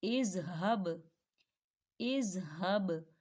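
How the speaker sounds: इज़हब read slowly, heavy हा, falling end; slow recitation of इज़हब. Shown as a demonstration. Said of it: "अगली मिसाल को देखें".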